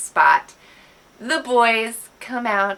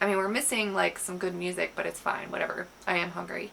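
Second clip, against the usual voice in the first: grudging agreement; 3 semitones lower, 9 dB quieter, faster than usual. Retold or imitated.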